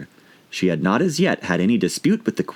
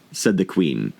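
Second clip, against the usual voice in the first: ordinary delivery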